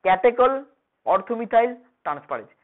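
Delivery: catechol, orthomethyl, transferase each cut into separate syllables. Shown as a demonstration. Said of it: "কেটে কেটে উচ্চারণ করবি সবসময়".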